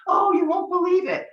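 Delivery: retold friend hyping her film, high and loud; gushing friend. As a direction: imitate the friend overselling it.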